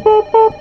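high squeaky boop boop like a ringtone; playful high-pitched ring noise; imitated phone ring. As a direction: mimic the phone ringing, high and bright. Mimicking the ringing phone.

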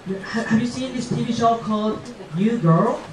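ordinary delivery

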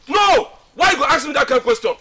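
high shouted protest; angry refusal. Acted. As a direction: burst out loudly, then keep arguing. Refusing friend's questioning.

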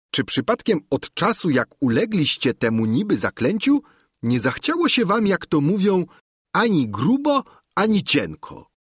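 plain-spoken servant arguing with his master, lightly played; Sancho Pansa. A narrator's reading.

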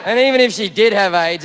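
normal talking voice

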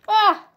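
short, high yelp; startled yelp. Real, not acted. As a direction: yelp as if suddenly hit. Hit in the game.

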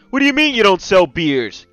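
loud, high indignant protest; outraged. Acted. Customer to bartender who won't sell beer, outraged. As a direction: shout it in disbelief. Customer told no beers are sold.

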